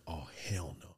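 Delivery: breathy whispered alarm. Retold or imitated. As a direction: whisper it so nobody hears. Quoted self, intruder in house.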